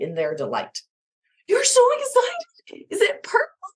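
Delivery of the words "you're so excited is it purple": delighted excitement. Shown as a demonstration. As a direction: share a child's joy over purple. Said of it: "join them emphatically, authentically in expressing your delight".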